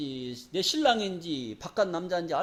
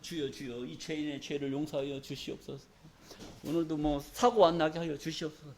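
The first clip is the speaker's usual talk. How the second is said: mock timid prayer; 5 semitones lower, 9 dB quieter than usual. Mocking a fearful weak prayer.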